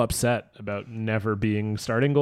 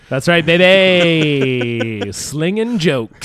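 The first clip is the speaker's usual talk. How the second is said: slow, loud lounge-comic swagger; hammed-up showman; 5 semitones higher, 8 dB louder, much slower than usual. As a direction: sell it like a cheesy headliner. Mock comedian bragging on tour.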